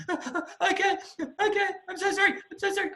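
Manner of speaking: very high, whimpering squeak; mock sobs between gasps as you give in; tearful apology, imitated. Student to instructor after pep talk, imitated tearful apology.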